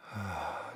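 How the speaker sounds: quiet audible out-breath; soft sigh. Shown as a demonstration. Meditation host leading another breath, soft sigh. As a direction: let a breath sink out softly.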